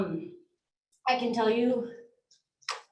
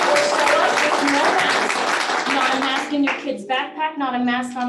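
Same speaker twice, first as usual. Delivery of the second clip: rallying shout; 2 semitones higher, 9 dB louder than usual. Real, not acted. Mother urging parents at the board meeting, shouting. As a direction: belt it out to rally the room.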